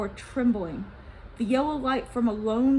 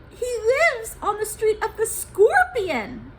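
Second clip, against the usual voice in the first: high, louder fearful voice; uneasy Gozan; 11 semitones higher, 7 dB louder than usual. Acted. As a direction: stress where he lives. Gozan answers.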